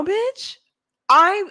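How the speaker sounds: ordinary delivery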